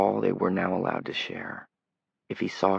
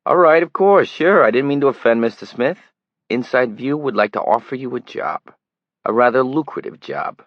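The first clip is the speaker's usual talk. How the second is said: louder, higher pushy Brooklyn reporter; a tabloid reporter pleads his long trip, loud Brooklyn accent; 5 semitones higher, 10 dB louder than usual. A narrator's reading. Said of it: "He had a flat city accent as well".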